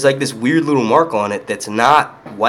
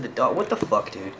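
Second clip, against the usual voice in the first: usual speaking voice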